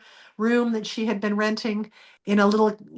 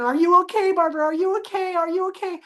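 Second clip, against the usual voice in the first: worried husband; 8 semitones higher, faster than usual. Retold or imitated. Husband anxiously checking after fall.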